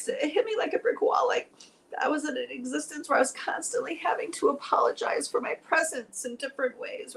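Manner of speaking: high, tearful voice breaking into whispers; crying for real while retelling the moment. Real, not acted. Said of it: "Oh, I'm gonna cry again".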